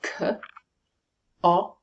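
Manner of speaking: K and o sounded separately; K... o, one sound at a time; sounds in isolation. Shown as a demonstration. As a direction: release K, pause, then a short o as in on. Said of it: "look at the sounds in that word".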